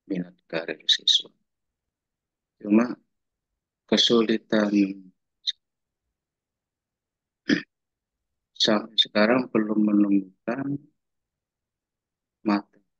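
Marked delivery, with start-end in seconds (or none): 2.6-12.9 breaking up over a bad line; choppy audio. Real, not acted. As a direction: dropouts in the connection. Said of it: "suaranya putus-putus nggak". (suara putus-putus)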